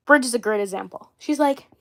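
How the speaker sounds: ordinary delivery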